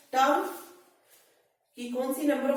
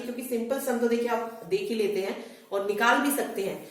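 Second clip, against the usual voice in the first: ordinary talk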